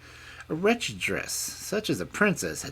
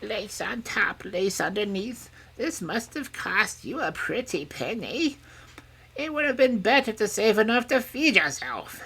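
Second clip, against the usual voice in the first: high crone voice, muttering; muttering old woman; 8 dB louder than usual. A narrator's reading. Old woman fingering the princess's silk robe, muttering. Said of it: "while she muttered".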